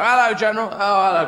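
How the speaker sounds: loud, high, breezy hello; mock casual greeting. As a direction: greet the general far too chummily. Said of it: "There are no casual armies".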